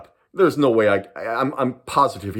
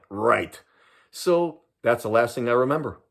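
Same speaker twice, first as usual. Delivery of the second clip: normal talking voice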